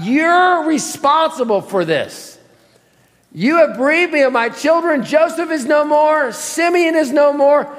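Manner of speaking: high, aggrieved father's accusation; Jacob. Acted. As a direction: pile up the losses, pitched high in reproach. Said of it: "this is what Jacob says".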